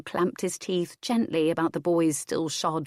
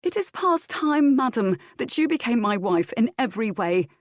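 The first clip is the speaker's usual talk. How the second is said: put-on young husband demanding; 6 semitones higher, 3 dB louder than usual. A narrator's reading. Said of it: "Fitztudor answered her".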